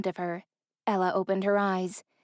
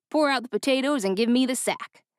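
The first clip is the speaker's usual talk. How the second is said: commanding driver; 4 semitones higher than usual. A narrator's reading. Driver ordering.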